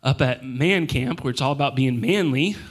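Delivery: ordinary delivery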